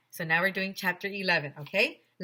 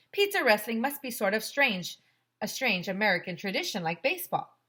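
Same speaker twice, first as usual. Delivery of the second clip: put-on British accent; 3 semitones higher, 4 dB louder than usual. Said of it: "the Guinness lady said".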